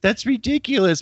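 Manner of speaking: high, slow, delighted squeal; amazed disbelief. Retold or imitated. Friend marveling at his own long cast, high amazed squeal. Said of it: "he was just like".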